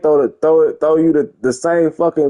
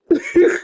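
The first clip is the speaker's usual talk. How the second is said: sharp high laugh. Real, not acted. Laughing at own play.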